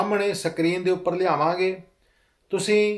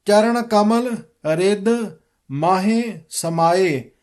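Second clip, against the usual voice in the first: slow, measured recitation of scripture; careful model reading; slower than usual. Shown as a demonstration. Teacher modelling the shabad for students, slow careful recitation. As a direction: read it out slowly, every word distinct. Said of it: "ਸ਼ਬਦ ਸ਼ੁੱਧ ਕਰਵਾਵਾਂਗਾ".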